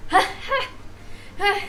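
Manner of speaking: high, short bursts of fake laughter; acted gloating "ha"; mocking laugh. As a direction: laugh triumphantly at a rival. Witness mocks the lawyer.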